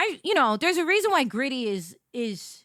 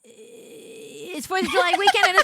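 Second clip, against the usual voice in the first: excited; 10 semitones higher, 4 dB louder than usual. Real, not acted. Exasperated outburst about anime takeover.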